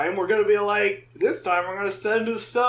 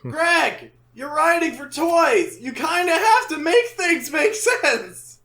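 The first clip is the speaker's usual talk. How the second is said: high-pitched comic exasperation; exasperated scolding; 6 semitones higher, slower than usual. Pretend. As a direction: plead indignantly with an absent writer. Exasperated rant at absent writer.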